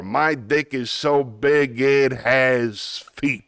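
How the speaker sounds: cartoon Brooklyn wiseguy accent; Brooklyn tough guy. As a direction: boast it like a Looney Tunes Brooklyn tough guy. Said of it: "Yes, it's a Brooklyn accent".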